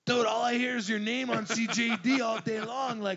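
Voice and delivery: loud, high mock buddy voice; imitating friends. Chef imitating his teasing friends, loud and high. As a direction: ham up a pushy friend. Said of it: "they're like".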